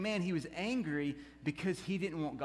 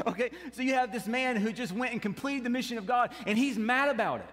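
rising preacher fervor; preaching; 5 semitones higher, 5 dB louder than usual. Acted. Preacher driving home Jonah's anger to the church, fervent. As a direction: drive the point home to the congregation.

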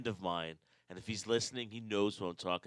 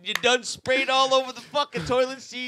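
high, loud laughing squeal; laughing through the pee story; 14 dB louder than usual. Real, not acted. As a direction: crack up while getting the words out.

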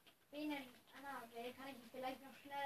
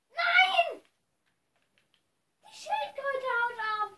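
high panicked yell; alarmed shout; 13 semitones higher, 15 dB louder, slower than usual. Real, not acted. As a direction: cry out in alarm. Turtle escapes in game.

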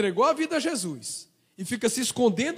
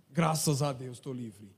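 relieved hush; 13 semitones lower, 7 dB quieter, slower than usual. Retold or imitated. Fugitive's relieved whisper.

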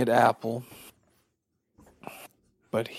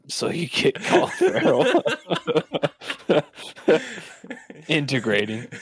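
laughing; 6 semitones higher than usual. Real, not acted. Laughing through own pitch.

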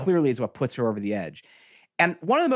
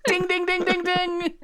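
high sung ding ding bell; bell sound effect. Pretend. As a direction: chime like a quiz show bell. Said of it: "a sound effect for this".

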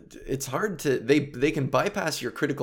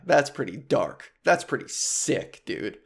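ordinary talk, nothing marked

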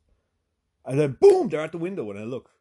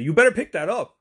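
raised, loud imitation of a bossy neighbor; mock scolding; 8 semitones higher, 9 dB louder than usual. Neighbor pointing at dog walker, loud mock scolding.

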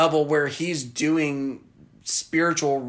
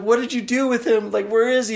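higher, louder panicked question; hammed-up frantic victim; 8 semitones higher, 4 dB louder, faster than usual. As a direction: demand where he is, scared. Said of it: "she's like".

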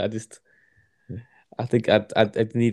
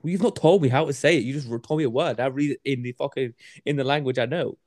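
high-pitched mock-defensive protest; indignant retort; 5 semitones higher than usual. Learner answering the mate who sent words, mock indignant. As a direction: retell an exasperated comeback, pitched up.

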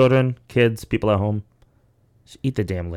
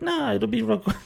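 high-pitched mock doctor voice; imitated breezy doctor, pitched way up; dismissive reassurance; 13 semitones higher, 3 dB quieter than usual. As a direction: brush off the worry in a high, airy pitch. Retold doctor brushing off Novocaine request.

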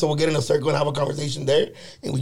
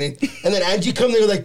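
ordinary talk, nothing marked

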